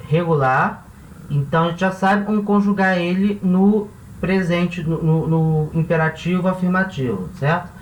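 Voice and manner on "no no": ordinary delivery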